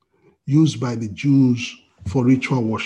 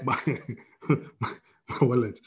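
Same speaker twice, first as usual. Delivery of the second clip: normal talking voice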